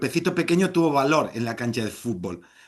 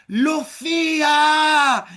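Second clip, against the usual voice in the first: high, loud, slow drawn-out name; long echoing call; drawn-out shout; 10 semitones higher, 6 dB louder, much slower than usual. Pretend. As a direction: stretch the name out in a calling yell. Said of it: "salió un sonido que decía".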